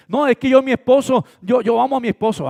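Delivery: hammed-up excuse of a wife; pleading wife. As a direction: whine it like the doting wife. Wife's excuse voiced.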